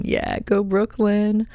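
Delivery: high, slow cheer for Brooklyn. Real, not acted. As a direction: hype up the hometown, drawn out. Cheering in the middle of a letter.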